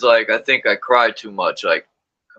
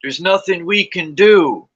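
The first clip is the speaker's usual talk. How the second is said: higher, louder, slow mock-defeatist "There's nothing we can do"; hammed-up hopeless "There's nothing we can do"; mocking resignation; 7 semitones higher, 4 dB louder than usual. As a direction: parody the helpless talkers, dragging "There's nothing we can do". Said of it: "they'll be like".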